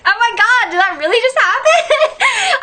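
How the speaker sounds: high wail ending in a laugh; mock distraught disbelief. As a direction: wail it in hammed-up shock. Reenacts disbelief while driving home crying.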